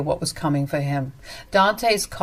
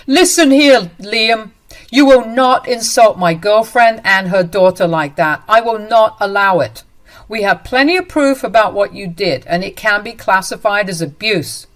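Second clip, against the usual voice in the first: louder, firm warning; stern anger; 3 semitones higher, 6 dB louder than usual. Acted. Dante warns Liam off insulting the family, raised voice. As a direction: Dante defends girlfriend and her daughter, angry and loud.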